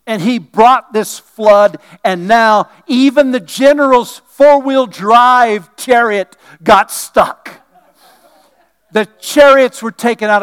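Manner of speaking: loud, slow, building sermon; preaching. Acted. Preaching at full force.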